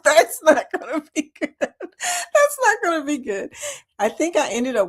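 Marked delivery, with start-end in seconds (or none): 0.0-1.5 laughing, a bit higher; amused at herself. Real, not acted. Telling viewers about a solo anniversary trip, laughing. 1.6-3.5 higher, giggling repeat; laughing harder. Real, not acted. Repeating the joke to viewers, laughing harder.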